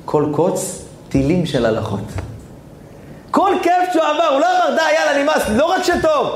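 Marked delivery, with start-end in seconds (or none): 3.3-6.3 high-pitched, fervent sermon cry; impassioned preaching. Acted. (דרשה נלהבת בקול גבוה)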